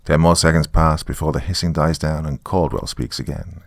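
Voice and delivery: plain reading voice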